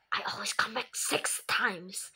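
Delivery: a whispered villain taunt; spooky whisper. Pretend. Kid voicing a game villain in a spooky whisper. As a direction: breathe it like a lurking ghost.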